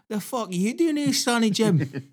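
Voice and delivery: high, loud mock Scottish yell; angry homeowner. Homeowner yelling at the man on his roof, outraged mock yell. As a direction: catch him at it, outraged. Voicing homeowner catching him.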